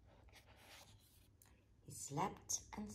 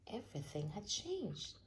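ordinary delivery

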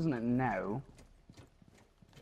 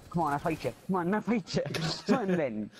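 mock taunt; 4 semitones higher than usual.

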